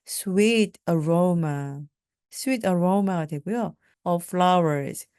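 slow, careful phrase; deliberate classroom pronunciation; slow teaching drill. Shown as a demonstration. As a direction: say each phrase slowly. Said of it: "slow phrase drill".

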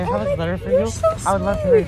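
very high, excited fan voice; starstruck. Real, not acted. Fan telling Olivia about her letter, very high and excited. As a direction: gush nervously at the star.

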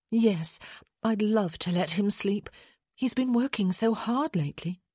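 a hint of wifely concern in a lifted voice; considerate lady. A narrator's reading. Wife speaking of her sleeping husband, gently concerned.

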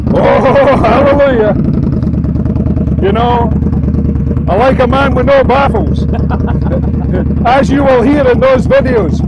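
loud, high-pitched delighted shouting with laughter; excited calling out. Real, not acted. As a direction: whoop over a roaring motorbike engine, laughing. Cheering on a loud motorbike.

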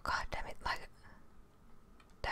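ordinary talk, nothing marked

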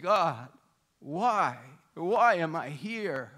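anguished plea. Retold or imitated. Prisoner's anguished prayer.

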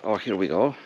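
usual speaking voice

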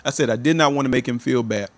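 normal talking voice

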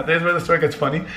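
ordinary talk

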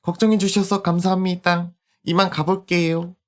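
a hint of a young girl; polite little girl voice; lightly voiced little girl.

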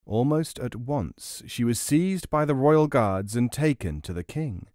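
ordinary delivery, nothing marked

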